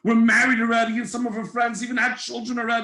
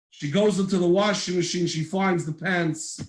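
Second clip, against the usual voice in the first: normal talking voice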